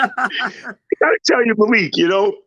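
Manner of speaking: high, laughing through the words; can't stop laughing while telling it. Real, not acted. Telling Malik the story, laughing. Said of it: "I'm laughing".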